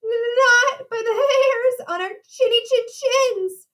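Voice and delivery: high, slow, quavering pig voice; trembling. A narrator's reading. Boris answers the wolf at the door, trembling refusal. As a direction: stutter into it and tremble with fear. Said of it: "trembled Boris".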